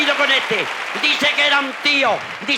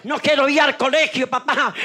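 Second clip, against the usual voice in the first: put-on whining child; 3 semitones higher than usual. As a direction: beg like a schoolboy who won't go. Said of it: "Le dice el niño al padre".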